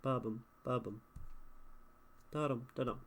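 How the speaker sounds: "Ba-bum" as weak-strong pairs; iambic stress. Shown as a demonstration. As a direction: beat out "Da-dum, da-dum" evenly. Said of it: "that's an iamb".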